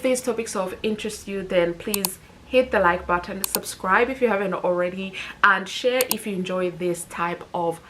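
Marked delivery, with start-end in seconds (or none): none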